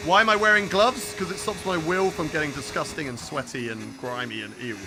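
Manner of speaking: ordinary delivery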